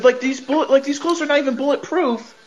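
ordinary delivery

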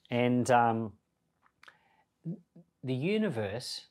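normal talking voice

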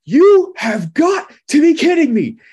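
high, loud, slow retold shout of disbelief; shocked yell. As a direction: belt it out like a stunned stranger. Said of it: "all I hear is her yell".

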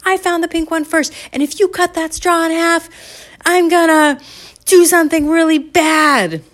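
put-on high, loud, indignant kid; hammed-up threat in a little girl's tantrum; child's tantrum. Candy protests cutting the straw, in a high loud tantrum. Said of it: "start throwing tantrums".

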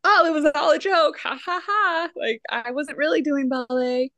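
mock embarrassed brush-off; fake nonchalance. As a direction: cover it up with a fake laugh. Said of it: "he's like".

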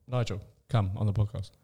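low appeal to camera. Shown as a demonstration. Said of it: "look at the camera and say it".

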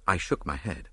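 ordinary delivery, nothing marked

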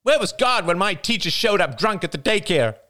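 high, bitter, accusing outburst; venomous passion. A narrator's reading. Jada accusing the praying family, high venomous voice. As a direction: spit out the grievances. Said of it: "Her voice trembled with venomous passion".

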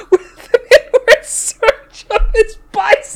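reading through rising giggles; trying not to laugh, voice climbing high. Real, not acted. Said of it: "this is way too much laughter".

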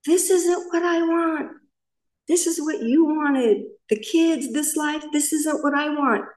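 high, slow, resentful tirade; mild mock of the husband yelling; retold yelling. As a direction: throw his complaint back at her. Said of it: "just yelling".

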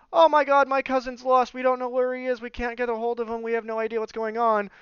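high, run-on mock panic; panicked cousin. Cousin reporting a missing relative at the embassy, panicked. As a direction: rattle off the worry on one high note.

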